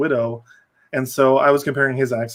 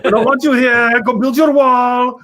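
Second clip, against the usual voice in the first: put-on high, louder Icelandic-accented yelling; mock Icelandic yell; 10 semitones higher, 6 dB louder, slower than usual. Quoting Will Ferrell yelling at Americans, mock Icelandic accent. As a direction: bark it like an angry Icelander. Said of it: "his accent made it funnier because he's yelling".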